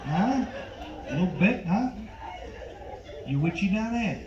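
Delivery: lightly voiced door-to-door salesman; a hint of a film tough guy, pausing between remarks; Robert De Niro. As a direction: leave gaps and tag on questioning grunts. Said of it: "my impression of Robert De Niro as a door-to-door Vagisil salesman".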